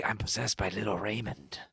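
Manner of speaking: low, soft mock confession; creepy mimicry. Imitating a channeler possessed by Little Raymond, creepy and hushed. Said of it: "That sounds creepy".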